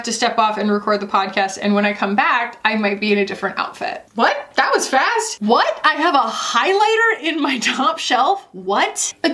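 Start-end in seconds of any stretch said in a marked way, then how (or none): 4.1-9.1 high rising what; mock surprise. Real, not acted. Vlogger gasping at her own highlighter, mock surprised. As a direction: feign shock at herself. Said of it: "the emphasis in my voice".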